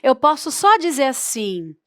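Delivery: ordinary talk, nothing marked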